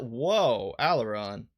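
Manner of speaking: ordinary talk, nothing marked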